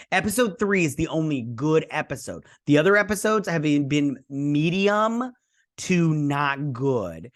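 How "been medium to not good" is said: slow drawn verdict. Real, not acted. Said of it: "drawn-out verdict".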